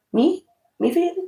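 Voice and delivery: high, lilting mock Irish "Me friend?". Tarot reader voicing the Irish mob she hears, mock Irish brogue. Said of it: "I just keep hearing that".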